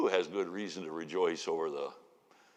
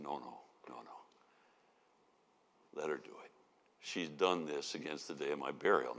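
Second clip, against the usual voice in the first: much softer and lower, tender and gentle; lovingly; 7 semitones lower, 8 dB quieter than usual. Retold or imitated. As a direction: hush him tenderly, like calming a friend. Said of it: "Jesus very lovingly said".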